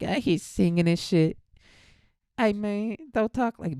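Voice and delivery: hammed-up [name] voice, rambling on; [name] impression. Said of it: "She talks like [name]".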